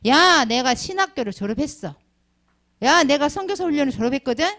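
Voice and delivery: smug graduate looking down on a friend; boastful brag. Retold or imitated. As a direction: show off to a friend. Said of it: "이렇게 말할 수가 있어요".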